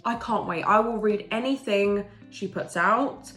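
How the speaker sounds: ordinary talk, nothing marked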